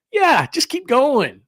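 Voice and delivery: high, slow mock exasperation; exasperated. Driver stuck behind rubberneckers, exasperated. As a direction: plead with gawkers in hammed-up annoyance.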